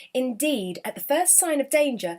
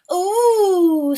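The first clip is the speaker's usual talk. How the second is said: loud, higher drawn-out ooh; a long loud impressed ooh; impressed goblins ooh; 8 semitones higher, 8 dB louder than usual. Acted. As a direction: goblins marvel at the news. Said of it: "said the goblins".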